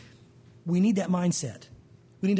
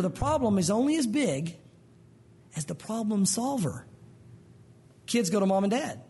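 ordinary delivery

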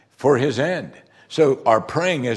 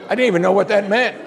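high, loud and fast recalled alarm; exclaimed bafflement; 9 semitones higher, 5 dB louder, faster than usual. Retold or imitated. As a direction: blurt it out loudly, pitched way up. Recalls childhood fright at the prayer.

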